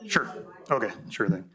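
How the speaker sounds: ordinary delivery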